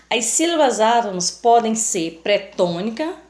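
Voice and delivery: ordinary delivery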